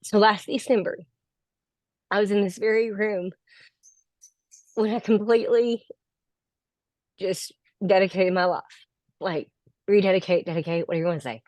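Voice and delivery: real emotion, halting with long pauses; fighting tears, stopping to hold it together; choked up. Real, not acted. Graduate thanking her coaching group, trying not to get emotional, choked up. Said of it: "I get emotional".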